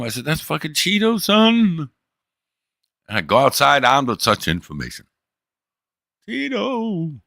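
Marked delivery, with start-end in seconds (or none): 0.2-1.9 much higher, thrilled exclamation; relieved owner seeing his lost cat; excited recognition. Retold or imitated. Recognizing the lost cat's photo. 6.3-7.2 long high call across the yard; calling the cat. Retold or imitated.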